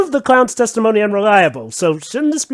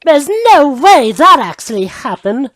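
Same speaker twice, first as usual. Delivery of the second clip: haughty prosecutor voice, higher and louder; Franziska von Karma voice; 4 semitones higher, 4 dB louder than usual. Retold or imitated. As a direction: sneer it like a proud prosecutor. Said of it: "In the words of Miss Von Karma".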